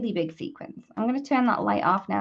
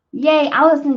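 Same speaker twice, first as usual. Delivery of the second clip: higher, louder cheer; cheerful; 6 semitones higher, 10 dB louder than usual. Real, not acted. Crafter cheering viewer to make shaker cards, excited. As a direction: cheer her on brightly.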